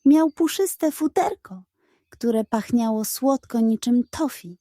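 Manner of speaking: ordinary delivery, nothing marked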